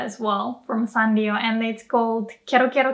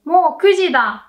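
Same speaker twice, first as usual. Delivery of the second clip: higher, louder Mo kuji da; surprised; 6 semitones higher, 6 dB louder than usual. Shown as a demonstration. Teacher gives an example of mo, surprised. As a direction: realise the time with a start. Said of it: "For example".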